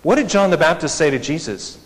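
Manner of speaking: ordinary delivery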